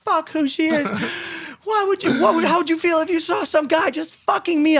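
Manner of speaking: high-pitched mock outraged girl; angry girl imitation. Jilted girl refuses to care who the woman is, high mock outrage. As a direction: screech her indignation up high. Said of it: "She goes".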